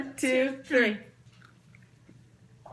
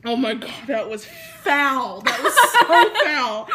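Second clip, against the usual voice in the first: laughing disgust; 3 semitones higher, 8 dB louder, slower than usual. Real, not acted. Reacts to gross bean.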